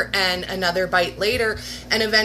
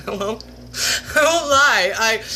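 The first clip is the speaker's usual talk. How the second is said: laughing. Real, not acted. Admitting to viewers, laughing. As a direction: crack up as you confess.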